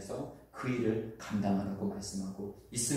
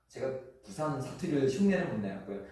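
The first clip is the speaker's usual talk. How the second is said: ordinary talk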